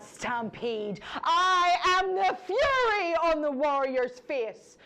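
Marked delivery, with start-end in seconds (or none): 1.2-4.8 high, loud battle cry on fury; furious cry. Acted. The war goddess boasting of battle rage, crying out furiously. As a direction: roar it out like war itself.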